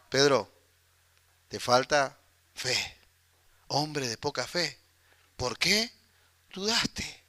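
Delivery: soft, slow, tender correction; Jesus gently correcting Pedro after rescuing him, soft and slow; gentle rebuke. Retold or imitated. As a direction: correct him kindly, almost under your breath.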